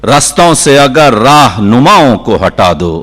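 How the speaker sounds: declaimed Urdu couplet, measured with strong pauses; poetry recitation. Acted. Poet presenting a couplet to a mushaira audience, declaiming. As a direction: recite the verse out to a full hall.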